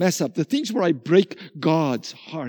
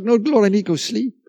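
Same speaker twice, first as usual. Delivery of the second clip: hurried brush-off; 3 semitones higher, faster than usual. Retold or imitated.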